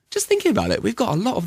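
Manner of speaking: high excited aside. Real, not acted. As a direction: jump up in pitch with the idea. Sudden idea.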